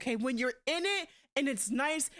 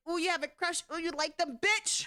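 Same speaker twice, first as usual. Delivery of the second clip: a lightly put-on teasing voice, higher; mocking singsong tease; 4 semitones higher than usual. Mocking how people tease about crushes, in a light put-on voice. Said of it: "when people say love".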